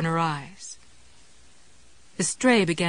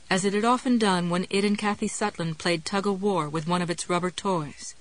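ordinary delivery

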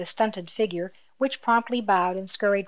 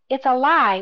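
louder, higher protest; indignant outburst, voice raised; strenuous objection; 4 semitones higher, 6 dB louder than usual. A narrator's reading. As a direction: object strenuously, as if wrongly accused. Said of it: "objected strenuously".

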